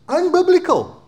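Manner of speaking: high, loud cry of protest; exclaimed. Acted. Preacher rejects the culture's rules, high and loud. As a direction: fling it out in disbelief.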